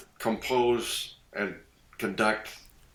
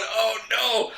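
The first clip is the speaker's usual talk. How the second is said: high, laughing oh no; laughing dismay; 13 semitones higher, 4 dB louder than usual. Retold or imitated. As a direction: groan at yourself through laughter. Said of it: "And I'm going".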